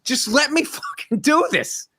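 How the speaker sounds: mock-exasperated, high "stop it"; annoyed himself. Husband retelling how he begged his wife to stop guessing, exasperated. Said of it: "It's just like".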